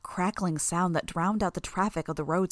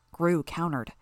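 plain reading voice

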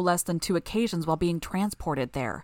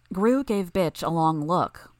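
ordinary delivery

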